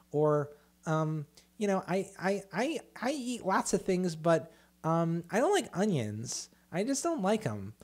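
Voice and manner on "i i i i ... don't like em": high-pitched picky eater; mock whining. Mimicking people who gripe about food, mock whiny. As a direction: whine like a fussy child, much higher. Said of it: "listening to somebody bitch about".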